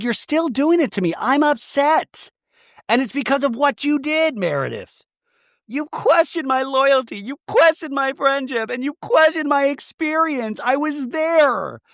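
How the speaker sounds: high, slow mock-upset pleading voice; upset Heather. Heather confronting Meredith about her loyalty, high mock-upset voice. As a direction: pile on each questioned, high and slow.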